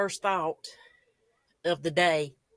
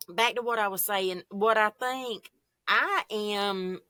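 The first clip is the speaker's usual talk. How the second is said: ordinary talk, nothing marked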